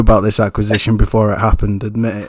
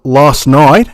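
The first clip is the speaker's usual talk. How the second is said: drawn-out, louder attempt at an Aussie accent; slow mock-Australian, voice raised; 6 dB louder than usual. Host mimics his Australian guest's speech, in an Aussie accent. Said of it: "I hope I'm pronouncing this right".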